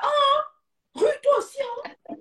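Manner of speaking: mocking scold. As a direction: sneer at the girl in a high shrill-free mock. Mocking a cheeky girl.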